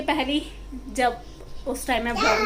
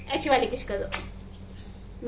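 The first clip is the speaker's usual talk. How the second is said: whisper; 8 dB quieter, much slower than usual. Real, not acted. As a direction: hush it, slowly and secretly, as if coaxing. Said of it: "coaxing baby softly".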